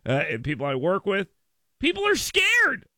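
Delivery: ordinary talk, nothing marked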